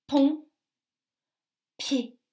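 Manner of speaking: PONT, PIED with a long pause between; separate syllables. Shown as a demonstration. Teacher helps children locate the p, syllables far apart. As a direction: punch PONT, then PIED, each alone. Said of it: "Dans quelle syllabe".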